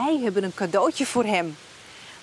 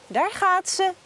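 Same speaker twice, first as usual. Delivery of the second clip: ordinary talk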